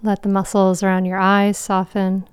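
ordinary talk, nothing marked

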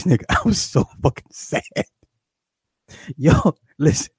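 usual speaking voice

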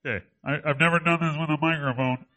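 speaking with the nose held shut; blocked-nose nasal Pidge impression; Pidge pinched-nose voice. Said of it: "I have to plug my nose to be Pidge".

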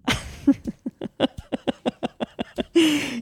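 real laughing fit; long laugh. Real, not acted. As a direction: laugh helplessly at a teasing insult. Laughs at being called fatter.

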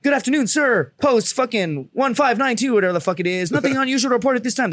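high, formal mock military report; duty Marine reporting. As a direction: rattle off a post report to a superior. Acts duty report to officer.

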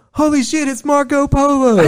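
high, loud, laughing recognition; a trainee spotting Rob at training, high laughing excitement; starstruck coworker. Retold or imitated. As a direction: squeal like you spotted a celebrity. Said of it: "this guy goes".

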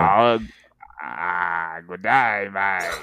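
hammed-up Aussie greeting, drawn out; amateur Australian accent. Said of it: "do it in an Australian accent".

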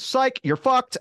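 high, loud taunting sing-song; mocking video guy. The video host ditching viewers, high loud mock taunt. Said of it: "And he just goes".

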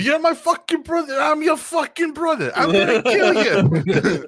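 put-on high, loud Brooklyn accent, slowing into a laugh; cartoonish Brooklyn threat; mock Brooklyn brothers. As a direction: ham up the mech scene in Brooklyn voices. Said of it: "from Brooklyn all of a sudden".

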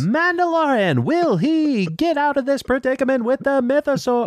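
high, booming sports announcer; hockey announcer voice. Acted. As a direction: call it like a hockey play-by-play. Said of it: "I'm talking like a hockey announcer".

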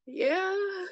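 high, drawn-out teary yeah; stretched wail, played up in the retelling; mock tearful. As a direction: imitate answering through tears. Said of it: "I just started crying".

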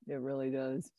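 ordinary talk, nothing marked

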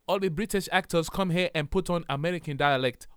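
playful mock Ghanaian accent; put-on Ghanaian accent. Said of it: "speak to me in the dialect".

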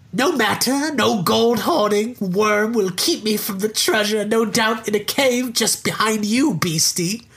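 sneering little leprechaun. Acted. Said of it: "I'll do the voice".

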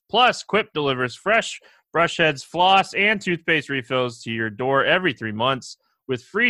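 upbeat, punchy sponsor read. Acted. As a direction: sell it like a radio ad. Resumes the sponsor ad.

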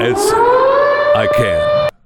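high, slow, drawn-out wail; exasperated wail. Real, not acted. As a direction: wail it in despair. Exasperated at bug news.

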